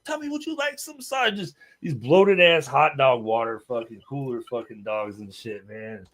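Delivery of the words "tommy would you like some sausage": high mocking offer; teasing taunt. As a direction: taunt him sweetly, pitched up. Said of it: "I said".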